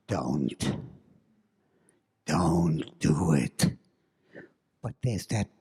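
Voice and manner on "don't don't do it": low, slow, ominous warning; inner warning. Acted. The narrator's body warns him off, low and slow. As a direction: growl it deep and slow like dread itself. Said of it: "every bone in my body was saying".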